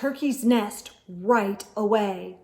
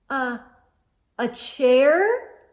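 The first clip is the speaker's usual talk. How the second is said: stammering; 3 semitones higher, 5 dB louder, slower than usual. A narrator's reading. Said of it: "Turkey stammered".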